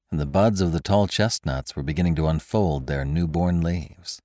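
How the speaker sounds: usual speaking voice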